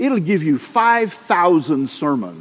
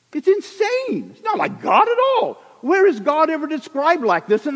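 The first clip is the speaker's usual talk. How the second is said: much higher, real outraged shout; outraged exclamation; 14 semitones higher than usual. Real, not acted. Preacher to congregation, outraged shout at the reading. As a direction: burst out in disbelief.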